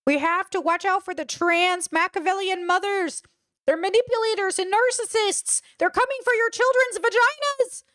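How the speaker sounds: high, mocking paranoid ranting voice; paranoid Ethan impression. Streamer mocks Ethan's paranoia, high parody voice. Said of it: "That's Ethan".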